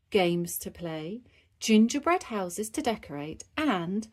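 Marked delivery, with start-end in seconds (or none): none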